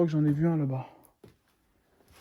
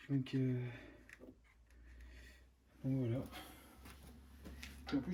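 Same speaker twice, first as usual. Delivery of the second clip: normal talking voice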